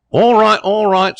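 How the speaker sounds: high, loud harried shout; calling out. A narrator's reading. Waiter answering a man wanting his bill, shouting. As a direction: yell back to an impatient customer.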